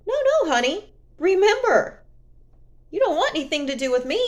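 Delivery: sassy reminder, sped up; taunting the ex who came back; sassy rebuff. Real, not acted.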